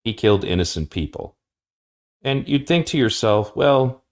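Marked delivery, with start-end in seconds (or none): none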